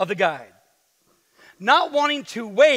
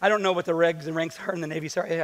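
usual speaking voice

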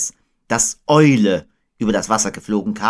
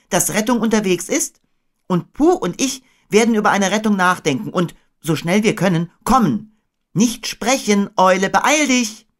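put-on high, urgent boy; Christopher Robin; 11 semitones higher, 4 dB louder than usual. A narrator's reading. Boy sending Owl to Piglet, high and urgent. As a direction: hurry the owl off in a raised pitch.

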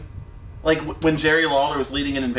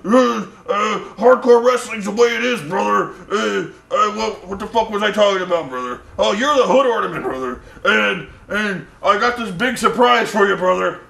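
higher-pitched, rambling wrestler promo with stalling grunts; Hulk Hogan; 3 semitones higher than usual. Retold or imitated. Host mocks a wrestler's rambling in-ring speech, hyped promo impression. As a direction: proclaim it like a pumped-up wrestler, losing the thread. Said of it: "He's like".

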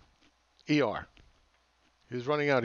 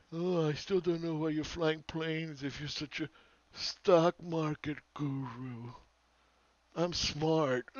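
higher, very slow mock of a smug know-it-all; hammed-up doofus; 4 semitones higher, slower than usual. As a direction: stretch every word to make him sound stupid. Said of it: "this doofus".

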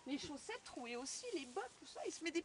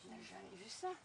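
whisper; 8 semitones lower, 7 dB quieter than usual. Real, not acted. Awed aside.

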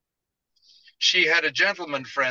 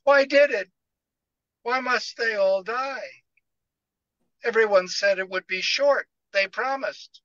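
tearful pleading; 7 semitones higher than usual. Acted. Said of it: "tear-streaked face".

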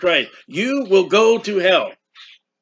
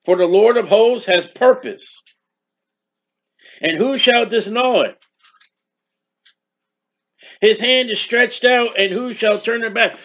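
measured pulpit reading of scripture; 4 semitones higher than usual. Acted. Reads scripture aloud.

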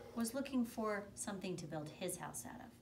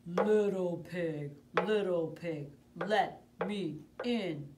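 loud, slow growling wolf at the door; big bad wolf; 10 dB louder, slower than usual. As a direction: boom it out like the hungry wolf. Wolf at brick house.

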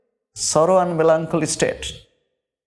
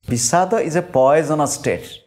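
ordinary talk

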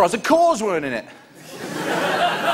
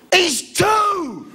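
outraged shout; 14 semitones higher than usual. Retold or imitated. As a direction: shout it in shock. Outraged at toddler supervisor.

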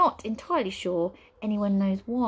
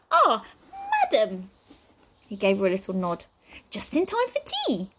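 fussy butler voice; 2 semitones higher than usual. Acted. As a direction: play the jittery butler.

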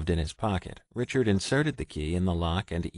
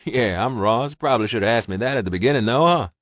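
louder, teasing gruff voice; amused Ross; 4 semitones higher, 7 dB louder than usual. A narrator's reading. Ross teasing Richard over the phone, amused. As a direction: needle him with a grin.

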